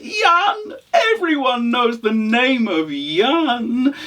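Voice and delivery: very high, drawn-out exclaiming; bluffing traveller. Acted. Traveller pretending to know Jan, effusive. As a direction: seize the name with overdone recognition.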